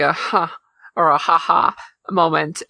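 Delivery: normal talking voice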